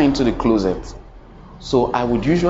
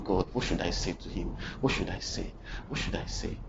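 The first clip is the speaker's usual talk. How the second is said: soft, fading to a whisper; anxious whispered prayer; 5 semitones lower, 13 dB quieter, faster than usual. Retold or imitated. Boy asking God under his breath what to say, fading whisper. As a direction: ask God under your breath, nervous.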